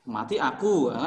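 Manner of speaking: ordinary talk, nothing marked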